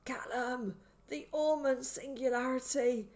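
high, strained, voiced stage whisper; haunt him with the last warning; haunting harsh whisper. A narrator's reading. Sedated man warns boy, haunting and harsh. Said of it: "in a haunting and harsh whisper".

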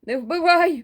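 high, loud panicked pleading; terrified plea to a devil; frightened babbling. Acted. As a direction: beg for your life in terror. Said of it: "пролепетав я".

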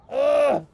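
loud, high groan of frustration; angry groan. Real, not acted. Player stuck on a puzzle, angry groan. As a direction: let out an exasperated ugh. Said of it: "I'm getting so angry".

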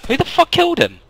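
high, loud outburst; startled, angry yell at teammates; outraged question. Pretend. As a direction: demand to know who did it. Captive shot against the speaker's wishes.

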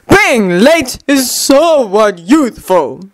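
slow, theatrical lament; hammed-up sorrowful drawl; mournful. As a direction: mourn it with drawn-out words. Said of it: "Lee said mournfully".

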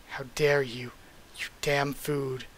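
whispered villain's threat; seething under the breath; hushed fury. Acted. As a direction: hiss the insult low, drawing out the last words. Villain's threat read aloud from manga.

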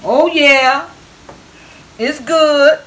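high, loud playful sermon shout; mock preaching. Cook preaching to viewers about the food, mock preacher. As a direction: proclaim it like a sermon title. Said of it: "The name of this sermon is gonna be".

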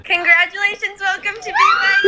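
high, loud, rising into a shout; excited reading. Real, not acted. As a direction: read out the good news with growing joy. [name] reads acceptance letter aloud.

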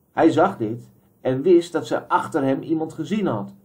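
usual speaking voice

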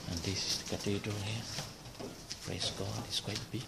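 hushed voice, barely voiced; whispering. Real, not acted. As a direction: speak hushed, like in church. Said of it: "I have to whisper because".

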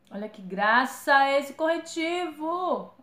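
loud, high, drawn-out squeal of delight; thrilled by how cute it is; delighted gushing. Real, not acted. Gushing over a cute concealer.

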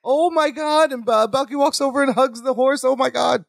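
high-pitched, dismayed exclamation; high, pleading dismay without raising the volume; dismayed outcry. Acted. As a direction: react in hammed-up distress at awful news. Horse must be put to sleep.

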